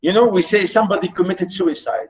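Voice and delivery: ordinary talk, nothing marked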